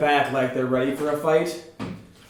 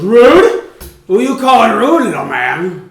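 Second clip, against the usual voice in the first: much higher and louder offended challenge; angry orc; 8 semitones higher, 11 dB louder, slower than usual. Acted. An orc challenges the little man who called him rude, loud angry retort. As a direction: take offence and throw it back at him. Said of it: "the orc next to you goes".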